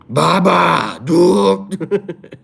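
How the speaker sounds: high, strained put-on voice for Baba; high character impression. Shown as a demonstration. Streamer trying a Baba impression for chat, high and strained. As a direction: push the voice up and pinch it.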